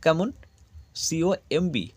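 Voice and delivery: ordinary delivery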